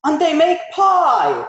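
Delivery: high excited goblin shout. Acted. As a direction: shout it out, tiny and eager. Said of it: "shouts the tiniest of goblins".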